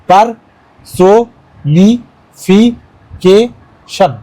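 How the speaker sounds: "পারসোনিফিকেশন" said very slowly, each syllable pulled apart; split into syllables. Shown as a demonstration. Teacher showing students the syllable breaks, slow and spaced. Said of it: "তাহলে কেমনি হবে?".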